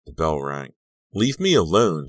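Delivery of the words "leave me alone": high, loud outburst; angry yell. A narrator's reading. Girl turning away from the boy pursuing her, angry yell. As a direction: yell to drive someone off. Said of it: "she yelled".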